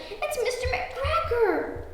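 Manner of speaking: very high, stretched-out mock panic; drawn-out frightened little rabbit cry; gasped. A narrator's reading. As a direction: squeal it in storybook alarm. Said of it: "gasped Peter".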